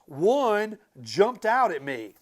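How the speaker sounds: plain reading voice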